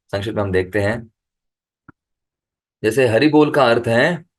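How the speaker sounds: usual speaking voice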